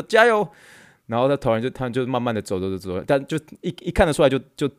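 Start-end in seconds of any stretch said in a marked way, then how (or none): 0.0-0.5 high, loud dutiful cheer; obedient shout. Retold or imitated. Startled students shout the reply, dutifully. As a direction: shout back on cue. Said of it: "很附和地".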